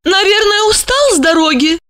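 high caring female voice. A narrator's reading. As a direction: ask with care for his tiredness. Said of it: "заботливо всматриваясь".

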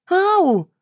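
high, loud yelp of pain; sharp hurt squeal; pained ouch. A narrator's reading. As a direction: cry out as a fang cuts the lip. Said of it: "застонала".

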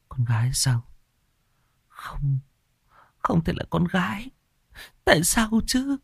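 whispered disbelief rising to a high cry; from a breathless whisper into anguish; mother learning her daughter is gone; stunned whisper. Acted. Mother hears her baby died.

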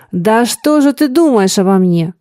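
ordinary delivery

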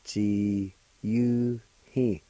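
syllables drawn out; slow careful pronunciation. Shown as a demonstration. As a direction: model each syllable slowly. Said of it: "Second part".

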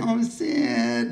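high whiny mock "Oh, Sid"; whiny Nancy Spungen. Storyteller imitating Christine's whiny voice, high and whiny. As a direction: whine it like a clingy punk girlfriend. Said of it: "that whiny thing".

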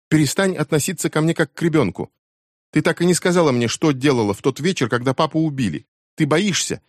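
a daughter exploding at her mother; furious teen. A narrator's reading. Becky's outburst at her mother. (яростный крик дочери, высоко и громко)

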